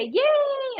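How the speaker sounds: high bright cheer, way up; a sudden high-pitched yay; cheerful yay. Shown as a demonstration. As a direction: cheer it like a celebration. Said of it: "I love to say".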